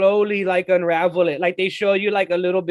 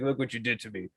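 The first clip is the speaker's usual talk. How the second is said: much softer, lower repeat; eerie low murmur; 9 semitones lower, 13 dB quieter than usual. Retold or imitated. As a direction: let it sink to a creepy murmur. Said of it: "He's like".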